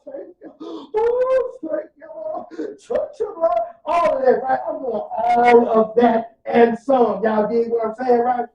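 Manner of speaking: loud, high, rising cries of thanks to the Lord; ecstatic praise. Retold or imitated. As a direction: shout your thanks to God like church.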